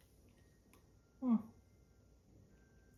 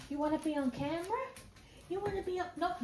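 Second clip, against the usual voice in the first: tender talk to the pet; 9 semitones higher, 5 dB quieter, faster than usual. Real, not acted. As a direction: coo at the dog, much higher. Baby talk to the dog.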